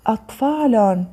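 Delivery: أطفال read with an added -un ending; tanween damm. Shown as a demonstration. Teacher reading out the word list, tanween damm on أطفال. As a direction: sound out أطفال as "atfaalun". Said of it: "ضم".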